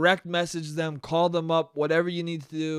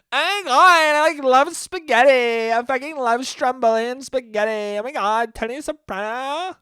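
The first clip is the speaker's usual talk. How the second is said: higher, louder Italian American caricature, gushing about food; 7 semitones higher, 6 dB louder than usual. Acted. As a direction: play a loud Italian American fan raving about spaghetti. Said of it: "That's the voice of an Italian American".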